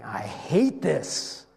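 slow, grudging hint of hatred; bitter mutter. Leaders watching the miracles, slow bitter hint of hatred. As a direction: spit it out slowly with resentment. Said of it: "that they can see wonderful things God is doing and saying".

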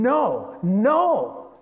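mock fury, protesting the praise; red-faced protest. Red-faced Pharisees objecting to the children, mock outrage. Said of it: "their faces are getting red, going".

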